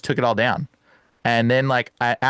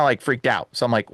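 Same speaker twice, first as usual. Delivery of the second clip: normal talking voice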